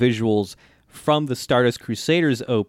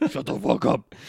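deep angry puppet voice. Retold or imitated. Host repeats puppet's retort after laughing, mock deep anger. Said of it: "goes into a very deep, angry voice".

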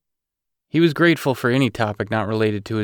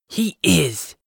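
higher, gasping with delight; excited gasp; 7 semitones higher than usual. A narrator's reading. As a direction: gasp it out thrilled. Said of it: "she gasped excitedly".

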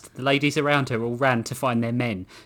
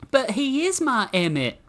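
high-pitched besotted woman; devoted woman; 11 semitones higher than usual. A narrator's reading. Samantha defending her returned husband, high doting voice. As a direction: play a doting wife in a high voice.

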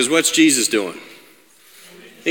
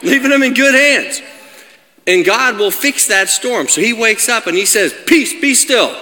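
high, emphatic, raised-voice sermon; full-force preaching; 10 semitones higher, 3 dB louder than usual. Real, not acted. Preacher assures congregation God has them, forceful preaching. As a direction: proclaim it phrase by phrase with conviction.

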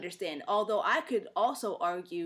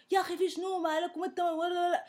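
high, rapid-fire mock outburst; character going off; 8 semitones higher, much faster than usual. Mimicking the video's character after a bump, going off. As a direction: imitate an over-the-top rant, pitched up and rushed. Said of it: "like, goes off".

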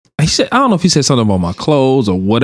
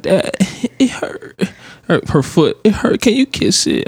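put-on high child voice, some words nearly whispered; a toddler pouting for a kiss, high pitched; little daughter's whine; 9 semitones higher than usual. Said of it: "And she like".

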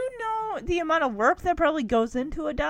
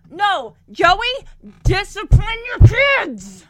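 loud, drawn-out scolding shout; raised, high, outraged bellow; exasperated yell; 5 semitones higher, 12 dB louder, slower than usual. Real, not acted. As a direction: yell it at the screen, stretching the words. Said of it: "I'm not yelling at you".

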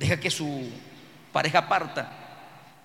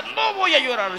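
acting strong; 7 semitones higher, 4 dB louder than usual. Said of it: "Se pone fuerte".

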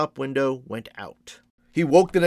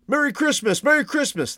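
high, lilting festive greetings; merry passers-by, pitched very high; cheerful calling out; 12 semitones higher than usual. A narrator's reading. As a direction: call to neighbours across the street. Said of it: "as they called to one another".

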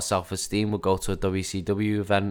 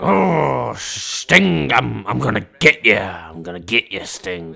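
mock Sid promo; 2 semitones higher, 4 dB louder, slower than usual. Imitating Sid's growly threat promo.

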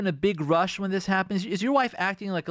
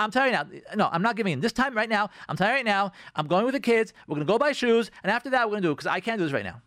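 raised, insistent dictating; acted bossy spouse; 5 semitones higher, faster than usual. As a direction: rattle off orders to your spouse. Acts out a spouse taking control.

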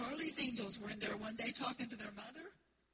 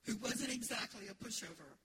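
ordinary talk

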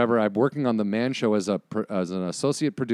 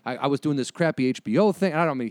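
usual speaking voice

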